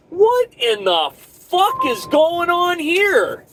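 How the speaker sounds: high, slow, drawn-out disbelief; exasperated yell. Pretend. Trucker venting at dispatch, slow high outrage. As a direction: stretch it out, outraged. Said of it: "be like".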